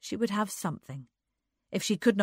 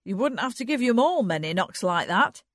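louder, lifted teasing; mock-challenging tease; 4 semitones higher, 6 dB louder than usual. A narrator's reading. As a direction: needle playfully, a bit louder. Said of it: "he teased".

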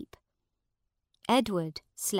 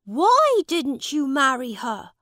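put-on high, louder young prince voice; the prince pressing a question; raised youthful royal question; curious boy king; 7 semitones higher, 8 dB louder than usual. A narrator's reading. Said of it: "said Edward".

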